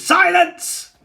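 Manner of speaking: high, loud commanding shout; shouted silence. A narrator's reading. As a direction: cut him off with a roar. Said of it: "Dagger shouts him down".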